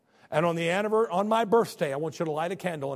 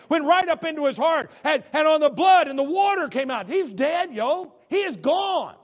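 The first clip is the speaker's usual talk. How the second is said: loud, high, driving; preaching at full force; 9 semitones higher, 8 dB louder than usual. Acted. Preacher describes the spear to the congregation, loud and high. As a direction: drive the crucifixion home.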